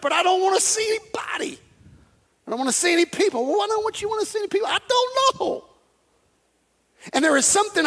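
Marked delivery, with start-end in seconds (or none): 0.0-5.6 whiny inner voice. Retold or imitated. Imagined inner dialogue.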